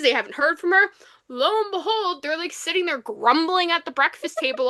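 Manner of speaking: usual speaking voice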